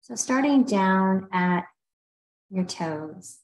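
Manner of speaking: unhurried meditation guide with long pauses; guided meditation. Real, not acted. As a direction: ease listeners through a body scan, leaving space between phrases. Leads a body scan meditation.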